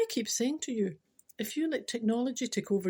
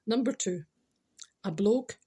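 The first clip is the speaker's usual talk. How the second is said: ordinary delivery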